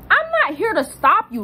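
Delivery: high-pitched, insistent comeback; exasperated retort. Retold or imitated. Retold retort in an argument.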